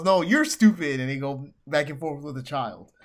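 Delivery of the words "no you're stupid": higher, louder mock retort; childish comeback. Host voicing Speed Demon arguing with a child, loud childish retort. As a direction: throw it straight back at her. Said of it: "He goes".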